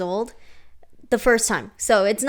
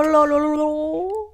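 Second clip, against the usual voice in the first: higher, playful nonsense word; silly made-up word; 6 semitones higher, 3 dB louder, slower than usual. Pretend. Teasing her cohost with a made-up word, playful. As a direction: toss out a goofy fake word. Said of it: "sometimes I make up words".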